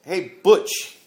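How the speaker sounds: loud, high mocking yell. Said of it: "I started yelling down at the cops".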